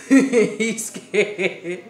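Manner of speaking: cracking up mid-sentence; laughing. Real, not acted. Retelling the scared pig, bursting into loud laughter. Laughing at her own story.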